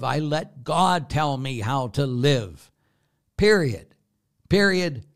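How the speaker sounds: loud, high, hammering each phrase; forceful preaching shout. Real, not acted. Priest challenging his congregation on whom they obey, forceful shouting. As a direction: raise the voice and preach it with full force. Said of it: "He said gently, kindly, and compassionately".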